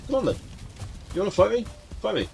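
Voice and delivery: high teasing challenge; mock taunting. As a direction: taunt it playfully. Taunting a game creature.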